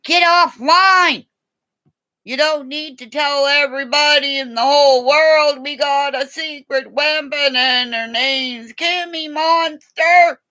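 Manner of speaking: high, loud mock-police yelling; mock cop shouting. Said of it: "the cops are saying".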